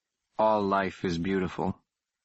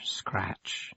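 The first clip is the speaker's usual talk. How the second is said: softer whispered scratch; eerie whisper; 6 dB quieter than usual. A narrator's reading. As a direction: let it fade to a hush.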